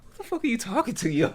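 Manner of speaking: high incredulous shriek. Retold or imitated. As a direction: squeal the disbelief. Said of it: "And I go".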